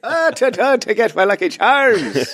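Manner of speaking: hammed-up Irish brogue, lilting; leprechaun. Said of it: "another leprechaun impression".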